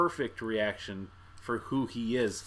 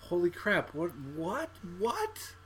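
stunned disbelief; 7 semitones higher, slower than usual. Retold or imitated. Podcaster voicing his stunned thought in the theater, high incredulous questioning. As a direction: replay being dumbfounded, voice rising into questions. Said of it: "sat there kinda like Dave with the thought".

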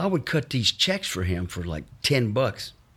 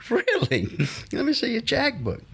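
store owner. Retold or imitated. Store owner voiced in story.

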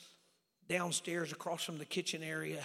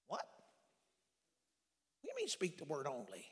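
puzzled disbelief; 4 dB quieter, faster than usual. Acted. Jesus puzzled, acted by preacher.

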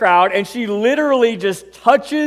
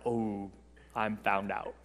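hushed dread; 11 semitones lower, 18 dB quieter, slower than usual. Acted. Heart sinks, caught.

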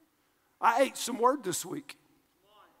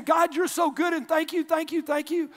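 imitating a worshipper's joyful thanks, higher and louder; mock grateful shout; 7 semitones higher, 4 dB louder than usual. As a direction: gush thanks like a happy churchgoer. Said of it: "did you just walk in saying".

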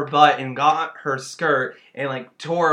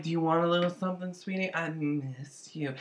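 much softer, mock sultry coo; seductive Tammy; 10 dB quieter than usual. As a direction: coo it to a lover on a webcam. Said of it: "She's like".

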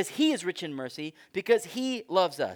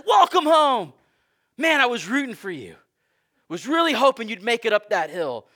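high, loud hearty welcome; God welcoming; 7 semitones higher, 9 dB louder than usual. Acted. God to the believer, loud hearty mock welcome. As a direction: cheer him in like a fan at the finish.

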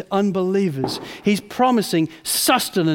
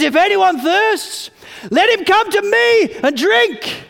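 high, loud, slow call; 12 semitones higher, 9 dB louder, slower than usual. Acted. Jesus calling out at the Feast of Booths, loud and slow. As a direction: cry it out across the crowd. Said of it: "in a loud voice says".

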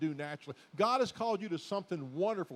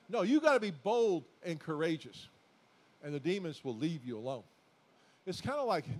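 ordinary talk, nothing marked

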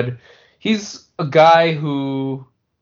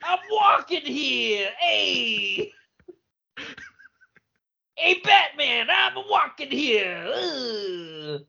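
high, slow street-tough shouting; New York gangster voice; 11 semitones higher, much slower than usual. Pretend. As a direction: yell like a cabbie blocking traffic. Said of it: "nineteen twenties Chicago gangster voice".

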